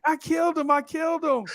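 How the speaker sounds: high frantic wail; little sister crying that she killed him; panicked sister. Retold or imitated. Sister running off crying, high frantic voice. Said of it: "She's like".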